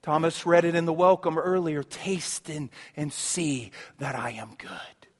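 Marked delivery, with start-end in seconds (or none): 1.8-5.1 breathy, much softer; soft whisper. Acted. Pastor passing on God's invitation to taste, whispered. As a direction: hush the invitation. Quoting scripture softly.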